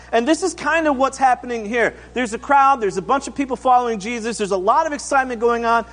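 normal talking voice

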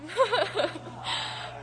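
shaky giggle before speaking; a short, jittery laugh; nervous laugh. Real, not acted. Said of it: "so I'm sorry if I sound nervous".

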